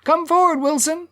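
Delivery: very high-pitched excited calls; eager high shouts from the hall; crowd voices. A narrator's reading.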